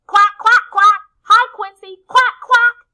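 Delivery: loud, high-pitched quacking shout; shouting duck. A narrator's reading. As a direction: holler it out like a very noisy duck. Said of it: "shouts Dottie".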